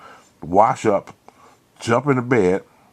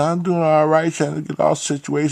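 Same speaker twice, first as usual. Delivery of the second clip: Martha, higher voice; 7 semitones higher than usual. Retold or imitated. Martha voiced in retold story.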